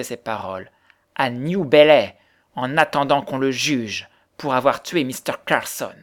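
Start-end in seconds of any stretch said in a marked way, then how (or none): 1.2-2.2 slow, loud, distinct "New Bellay"; distinct articulation. A narrator's reading. Widow pressing the girl, "New Bellay" loud and deliberate. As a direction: hammer "New Bellay" out slowly. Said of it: "articula distinctement la veuve".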